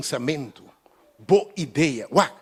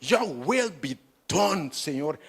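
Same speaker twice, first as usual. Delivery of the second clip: yielding the will to God; slow surrendering prayer; slower than usual. Shown as a demonstration. Preacher voicing the surrendering prayer, slow and humble. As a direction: say Your will be done slowly and humbly. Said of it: "Me diz, Senhor".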